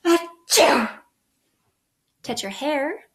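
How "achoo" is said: a big playful fake sneeze with a gasp first; gasp, then a hearty mock achoo; pretend sneeze. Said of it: "pretend you're going to sneeze".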